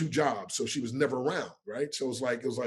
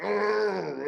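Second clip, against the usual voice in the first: drawn-out "argh" growl, pitched up; mock rage growl; 7 semitones higher than usual. Man imitating his raging younger self, mock growl. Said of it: "I was just in a rage".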